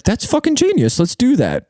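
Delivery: studio exec. Retold or imitated. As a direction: ham up a clueless TV executive loving the idea. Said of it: "some studio exec was like".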